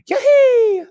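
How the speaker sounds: very high, loud yelp of glee; wife celebrating the overflowing pantry with a whoop; joyful whoop. A narrator's reading. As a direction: let out a wild cheer of joy. Wife overjoyed at more gold.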